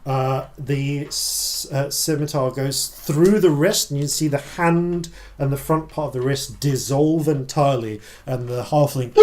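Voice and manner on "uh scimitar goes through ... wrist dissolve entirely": ordinary talk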